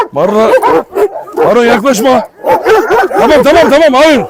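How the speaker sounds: loud, high commands; shouting at the dogs. Real, not acted. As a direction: yell to stop the dogs. Stopping dog fight.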